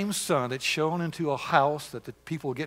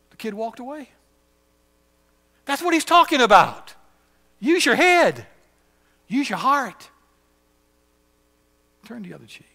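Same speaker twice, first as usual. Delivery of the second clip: rising, louder preacher's call; preaching; 8 semitones higher, 6 dB louder than usual. Acted. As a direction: preach it out with fire. Preacher builds to full force.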